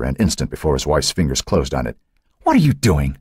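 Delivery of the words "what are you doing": higher-pitched indignant question; demanding. A narrator's reading. Wife challenges husband taking the decanter away, demanding. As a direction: demand an explanation. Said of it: "Phyllis demanded".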